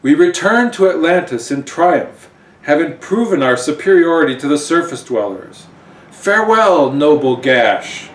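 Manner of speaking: loud, grand regal farewell; queen leaving for Atlantis, triumphant. Acted. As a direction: proclaim it like a victorious ruler. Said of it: "and saying".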